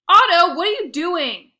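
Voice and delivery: loud, high yell across the field; coach yelling. A narrator's reading. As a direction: bellow at the player from afar. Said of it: "yelled Coach".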